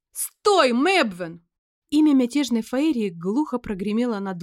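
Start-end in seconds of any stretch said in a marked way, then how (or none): 0.1-1.5 commanding shout. Acted. Commanding cry to stop fairy.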